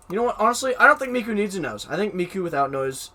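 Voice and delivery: high, loud bold declaration. Real, not acted. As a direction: announce it boldly. Declares [name] needs no nose.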